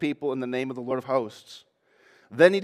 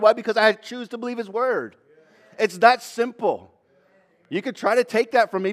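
higher, louder, fervent declaration; full-force preaching; 6 semitones higher, 3 dB louder than usual. Real, not acted. Preacher declaring faith to the congregation, fervent. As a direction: proclaim it with certainty.

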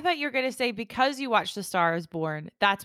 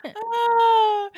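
high laughing cry; 12 semitones higher, 6 dB louder than usual. Real, not acted. Laughing reaction to the story.

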